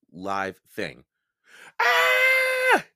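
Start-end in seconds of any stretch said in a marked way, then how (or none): 1.8-2.9 sudden high piercing scream; scream of fright. Acted. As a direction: shriek as something touches you. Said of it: "he cried".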